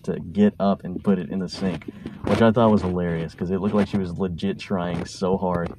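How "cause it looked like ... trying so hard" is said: ordinary talk, nothing marked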